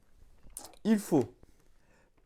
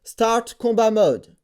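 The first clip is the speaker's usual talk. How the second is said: slow, louder, carefully spoken English command; 5 semitones higher, 5 dB louder, slower than usual. Real, not acted. Switching on combat mode by voice, slow and careful. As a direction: spell out each word for the machine.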